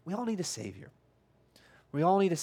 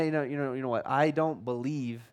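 lower, softer voice of a doubting listener; imitated objector; 7 semitones lower, 4 dB quieter than usual. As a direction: lightly play the unconvinced churchgoer, low and quiet. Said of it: "you're going".

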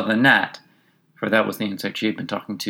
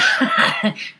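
high laugh. A narrator's reading. Passenger laughs.

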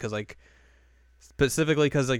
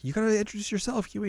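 high imitation of the girls; bandmates prompting; 11 semitones higher than usual. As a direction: nudge her in a pitched-up character voice. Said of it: "then they're like".

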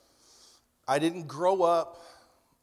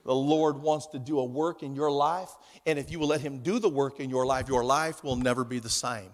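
put-on Southern accent; 5 semitones lower, 4 dB louder than usual. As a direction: imitate a preacher's Southern accent, pitched down and loud. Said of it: "talked with a Southern accent".

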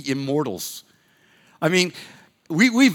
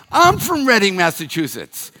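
excited; 6 semitones higher, 5 dB louder than usual. Retold or imitated. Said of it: "I'm like".

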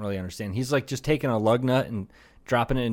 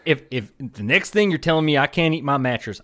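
ordinary talk, nothing marked

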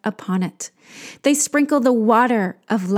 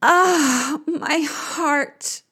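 sighing; 5 semitones higher, much slower than usual. Acted. Queen of the underworld lamenting, sighing high. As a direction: sigh it out in sorrow. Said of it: "Ereshkigal sighs".

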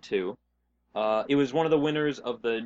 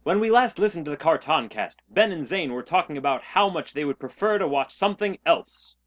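announcer narration; 5 dB louder than usual. Acted. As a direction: read it like a cartoon narrator. Podcast intro narrated in show style.

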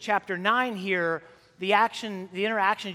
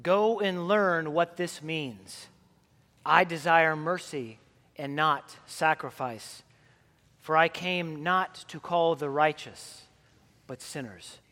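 solemn gospel reading; 3 semitones lower than usual. Acted. Priest reading the gospel to the congregation, solemn and measured. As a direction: read the gospel as at the altar, unhurried.

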